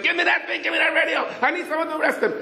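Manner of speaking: high, rushed yell; frantic demand. Retold or imitated. Patrolman fights for the radio.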